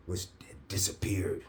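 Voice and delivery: whisper. Retold or imitated. Nurse reveals virus gone.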